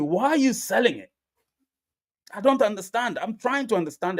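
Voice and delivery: normal talking voice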